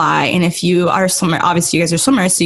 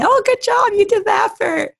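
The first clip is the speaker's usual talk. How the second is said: mock cheering praise. Mocking people clapping after race.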